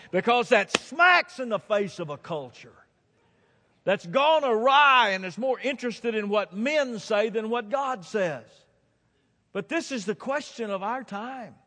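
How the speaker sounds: high, loud full-force preaching; pregação fervorosa. Real, not acted. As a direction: drive it home from the pulpit, high and loud.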